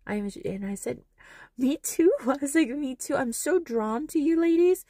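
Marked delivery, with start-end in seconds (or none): 1.6-4.8 high, loud, laughing delight; delighted gushing. Retold or imitated. Answering the grandma's thanks, high and delighted. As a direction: squeal it back with a laugh.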